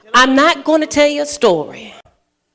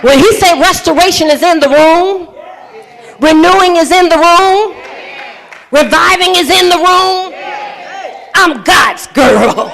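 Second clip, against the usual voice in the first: loud, high, shouted preaching over music; fiery climax of a sermon, shouting over the organ; preaching at full force; 6 semitones higher, 4 dB louder than usual. Acted. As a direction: lift the room with rising shouts.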